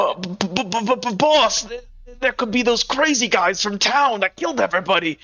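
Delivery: high, stuttering nervous underling; frightened henchman. Acted. Henchman warns boss about killers from town, scared stammer. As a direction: stammer in acted panic at the boss.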